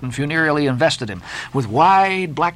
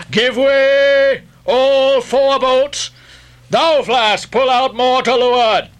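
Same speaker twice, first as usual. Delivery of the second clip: high, drawn-out commands; crying out; 8 semitones higher, 4 dB louder, much slower than usual. A narrator's reading. Ahab ordering Flask and all four boats, crying out. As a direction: call orders across open water. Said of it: "cried Ahab".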